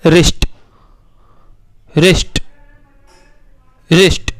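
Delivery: रिस्ट said clearly with no W; reading the word. Shown as a demonstration. Teacher modelling the word for children. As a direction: say रिस्ट as rist, silent W. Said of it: "अब इसे पढ़ना ज़रा".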